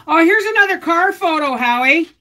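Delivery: high, loud call to another room. Real, not acted. Calling to her husband Howie about a photo, loud.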